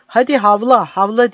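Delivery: high, loud taunting shout. Acted. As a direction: yell it down at the captive, mocking. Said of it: "diye bağırdı Vasos". (yüksek sesle alaycı bağırış)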